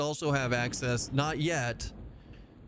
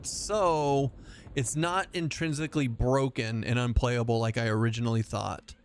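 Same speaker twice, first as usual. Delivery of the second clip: ordinary talk, nothing marked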